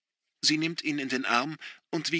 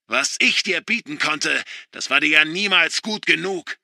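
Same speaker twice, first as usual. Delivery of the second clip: loud, higher bitter shout; yelling angrily; 5 semitones higher, 8 dB louder than usual. A narrator's reading. Father yelling at his son, loud and bitter. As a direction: roar it at him. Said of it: "wenn er wütend ist und herumbrüllt".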